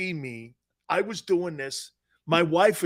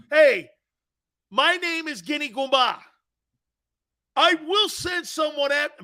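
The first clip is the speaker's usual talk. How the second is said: far higher, loud and stretched-out yelling at the ref; heckling shout; 10 semitones higher, 10 dB louder, slower than usual. Retold or imitated. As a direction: lose control and bellow at the referee. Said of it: "So I'm like this".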